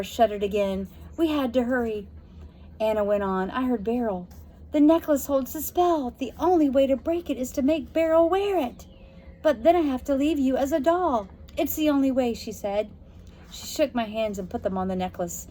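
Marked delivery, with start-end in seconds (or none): 4.7-12.3 put-on higher, urgent girl; worried little sister; younger girl voice. A narrator's reading. Anna warns Josie about the necklace.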